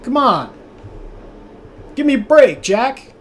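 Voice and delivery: put-on high, raspy-old-man Biden bark, fast and pushy; Biden impression. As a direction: do a cranky Joe Biden demanding an answer. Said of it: "Biden impression".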